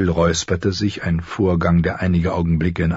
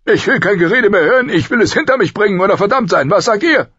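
loud, much higher, fast tirade; furious king; 6 dB louder, faster than usual. Acted. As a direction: rush it out in royal fury. Robert furious, ending debate. (wütender König, laut und schnell)

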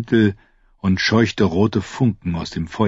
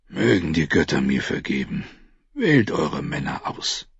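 weary and sad; 3 semitones lower, 5 dB quieter than usual. A narrator's reading. Mormont, weary and heavy.

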